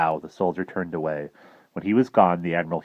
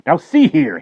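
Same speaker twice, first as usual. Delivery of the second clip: higher, louder cranky snap; crabby; 10 semitones higher, 11 dB louder than usual. Acted. Professor demanding answers from the admiral, crabby. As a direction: bristle with annoyance. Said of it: "he said crabbily".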